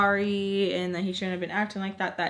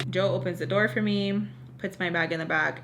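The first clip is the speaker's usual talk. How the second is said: normal talking voice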